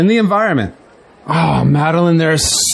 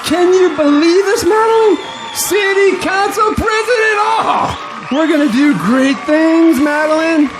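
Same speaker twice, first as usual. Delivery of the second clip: excited shouting; 7 semitones higher than usual. Acted. Bob shouts to Madeline after winning, thrilled. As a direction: shout it out like you just won the election. Bob celebrates election win.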